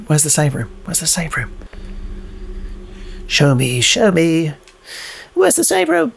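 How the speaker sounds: normal talking voice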